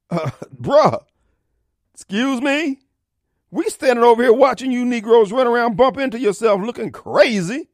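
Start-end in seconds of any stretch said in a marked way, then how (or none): 0.1-2.8 higher, disbelieving "excuse me"; mock-offended with a laugh; incredulous retort.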